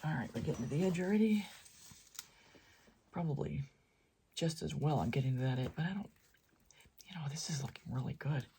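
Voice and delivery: soft, half-whispered musing; hushed near-whisper. Real, not acted. Painter murmuring to self while watching the edge, hushed near-whisper. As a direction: drop to an absorbed near-whisper. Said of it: "Why am I whispering?".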